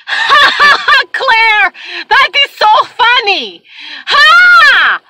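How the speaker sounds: very high, loud hammed-up laughter; mock cackling. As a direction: shriek with exaggerated laughter, imitating her. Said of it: "she goes like this".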